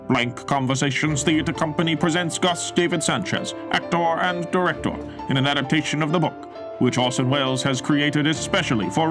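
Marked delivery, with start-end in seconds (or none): none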